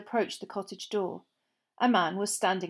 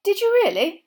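nervous; 11 semitones higher, 10 dB louder than usual. A narrator's reading. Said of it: "said Mister Weasley nervously".

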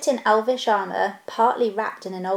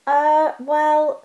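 awkward, higher and louder hesitation; uncomfortable; 6 semitones higher, 5 dB louder than usual. Acted. Bilbo asked how the stone is his, uncomfortable. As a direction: fumble for words, embarrassed. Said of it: "said the hobbit uncomfortably".

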